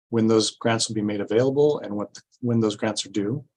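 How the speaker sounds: ordinary delivery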